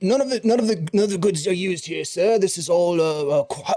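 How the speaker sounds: a fussing merchant eager to help; fussy shopkeeper Gremag. Acted.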